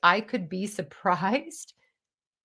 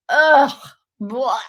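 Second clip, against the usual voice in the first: mock disgust; 4 semitones higher, 9 dB louder than usual. Mocking know-it-all people.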